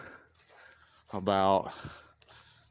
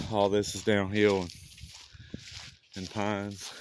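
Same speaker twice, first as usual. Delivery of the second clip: ordinary delivery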